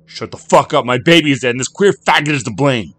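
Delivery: loud, high furious tirade; enraged. A narrator's reading. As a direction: shout it down in a rage.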